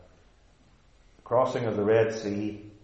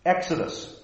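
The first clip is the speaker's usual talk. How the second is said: ordinary delivery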